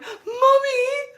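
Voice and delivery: very high, loud squeal for mom; hammed-up high-pitched wail; mock child's cry. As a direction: call out like an overexcited little kid. Acted childish cry to her mom.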